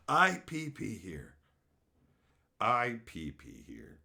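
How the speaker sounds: slow, low deadpan quote; imitated stranger. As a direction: repeat it low and drawn out. Repeats the stranger's odd line, dumbfounded.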